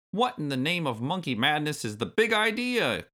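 put-on high, crabby old-timer outburst; indignant yell. A narrator's reading. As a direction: yell it like an outraged granddad. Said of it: "yelled Cranky".

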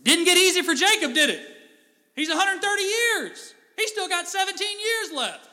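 loud, high preaching climax; preaching hard. Real, not acted. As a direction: drive the point home to the congregation. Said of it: "preaching at full force".

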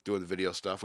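ordinary talk

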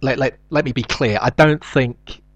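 ordinary talk, nothing marked

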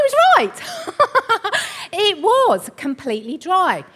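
high, loud laughing delivery; laughing admission. Real, not acted. Speaker admitting husband was right about soil, laughing out loud. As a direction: crack up while admitting it, voice shooting up. Laughing at her own story.

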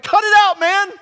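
loud, high retold taunt; mock defiant shout. The third son mocking the king, shouted. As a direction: shout it back at the tyrant, playfully.

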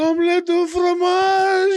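high, slow squeaky cartoon kid; mimicking a cartoon line high and slow; Dexter cartoon voice. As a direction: drag it out like a cartoon boy genius. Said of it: "Dexter's Laboratory".

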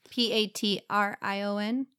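P A T R I O N spelled letter by letter, questioning; spelling it out. Real, not acted. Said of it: "how do you spell it".

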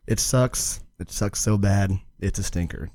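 low, soft deadpan echo of the co-host; mock-solemn low verdict. Echoes co-host's verdict as title read.

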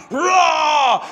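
a loud mock lion roar. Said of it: "as a roaring lion".

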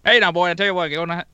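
Boomhauer. Retold or imitated. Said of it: "sound like Boomhauer".

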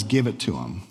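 calm command. Acted. Alexander's calm royal command.

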